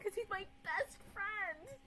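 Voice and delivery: high-pitched, drawn-out mock gush; put-on cutesy voice. Streamer gushing about a best friend, high put-on voice. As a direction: squeal it slowly like a fake V-tuber.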